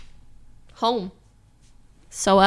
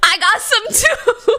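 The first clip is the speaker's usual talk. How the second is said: cocky laughing taunt; 6 semitones higher, slower than usual. Retold or imitated. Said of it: "I was like".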